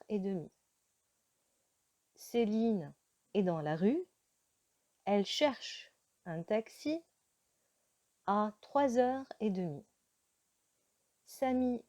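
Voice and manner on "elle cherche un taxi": ordinary talk, nothing marked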